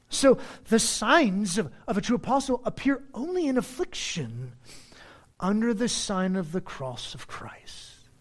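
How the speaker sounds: ordinary talk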